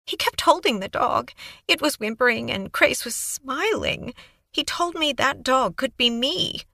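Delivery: put-on high, breathless young woman babbling on; Kaylee's eager voice. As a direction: gush it out like a nervous, eager witness. Kaylee recounting.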